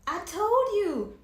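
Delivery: loud, high, slow told-you-so; smug loud I told you; satisfied. A narrator's reading. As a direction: gloat slowly and loudly. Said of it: "replied a very satisfied Mel".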